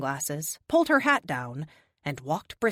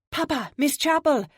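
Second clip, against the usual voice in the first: excited call; 9 semitones higher, 4 dB louder than usual. Acted. Girl calls excitedly to father.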